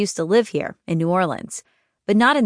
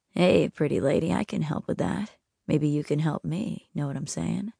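creepy stranger; 2 semitones lower, 7 dB quieter than usual. A narrator's reading. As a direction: lean in and lure her. Creepy stranger follows them.

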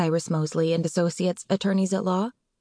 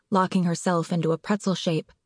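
ordinary delivery

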